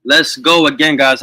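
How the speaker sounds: loud, high hype call echoing the song; hyped shout. Real, not acted. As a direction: pump up the chat after the track. Host hypes after song ends.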